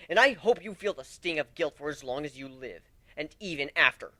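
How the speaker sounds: rising hateful threat; cold fury. Acted. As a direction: spit every line at her. Hateful letter to Ember.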